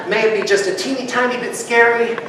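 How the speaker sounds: usual speaking voice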